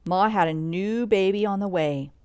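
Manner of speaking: ordinary delivery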